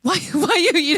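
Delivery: a hint of an angry Korean mom, laughing; lightly voiced scolding mom; mock mad Korean mom. Preacher mimics a mad mom scolding a doubter. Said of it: "like some mom, mad Korean mom".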